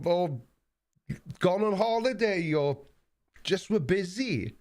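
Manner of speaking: excited greeting. Real, not acted. Welcoming back Merlin and Tracy on stream, high and thrilled. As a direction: greet them like long-lost friends. Greets long-absent viewers.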